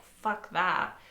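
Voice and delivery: ordinary talk, nothing marked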